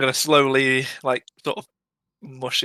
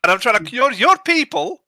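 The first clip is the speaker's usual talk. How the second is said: fast, exasperated rant; angry adventurer; 8 semitones higher, 6 dB louder, faster than usual. Acted. Adventurer scolding the priest, exasperated and fast. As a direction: snap at the priest in a rush. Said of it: "why you're getting so angry".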